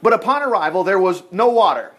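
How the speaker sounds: normal talking voice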